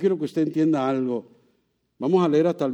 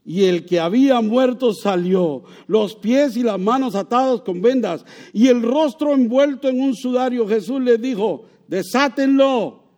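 loud, raised, triumphant reading; fervent preaching; 8 semitones higher, 7 dB louder than usual. Acted. Preacher reading Lazarus rising to the congregation, fervent preaching. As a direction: proclaim the miracle to the crowd.

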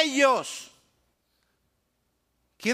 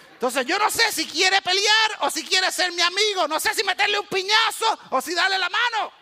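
put-on confused boy; 10 semitones higher, 4 dB louder than usual. Boy unsure whether to fight.

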